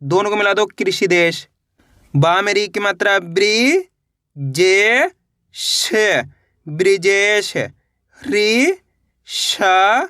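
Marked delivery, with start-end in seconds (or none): 2.2-6.2 very slow, each syllable drawn apart. Shown as a demonstration. 8.3-10.0 very slow, each syllable drawn apart. Shown as a demonstration. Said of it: "कैसे पढ़ेंगे?".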